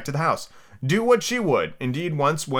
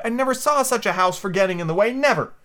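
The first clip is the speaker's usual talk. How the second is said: high exasperated complaint; crying out in frustration; 9 semitones higher than usual. A narrator's reading. Alice complaining about the house in her way, high cry. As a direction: protest high, punching the final never. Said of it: "she cried".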